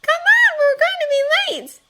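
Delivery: put-on high, loud child's voice urging him along; little girl calling. A narrator's reading. Little sister urging her brother not to be late, high and loud.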